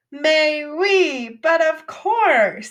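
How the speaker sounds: hammed-up French mock. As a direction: ham up the snooty French "mais oui, but of course". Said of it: "So we don't talk like that in English".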